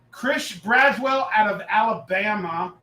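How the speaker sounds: ordinary delivery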